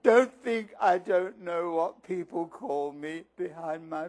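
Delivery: lightly voiced Myrtle wallowing in hurt; self-pitying ghost girl. Myrtle listing her nicknames to the students, slow and self-pitying.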